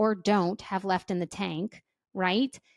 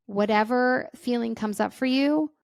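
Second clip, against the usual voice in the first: ordinary talk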